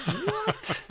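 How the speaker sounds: a squeaky high disbelieving what, half laughing; shocked what. Retold or imitated. Husband stunned by the score, squeaky high laughing what.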